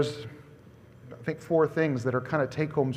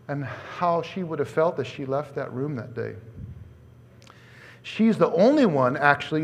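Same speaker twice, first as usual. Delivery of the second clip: ordinary talk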